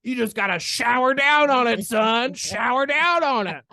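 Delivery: loud, high South Carolina holler; hammed-up South Carolina mentor urging hard, vowels stretched; South Carolina accent. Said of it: "He was from South Carolina".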